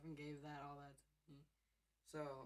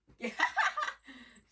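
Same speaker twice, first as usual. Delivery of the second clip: loud laugh; 12 semitones higher, 18 dB louder than usual. Real, not acted. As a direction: burst of high laughter. Cat does a flip.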